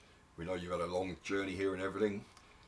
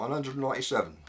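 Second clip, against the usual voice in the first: announcing count; 6 semitones higher, 7 dB louder than usual. Acted. Judges counting hairs aloud.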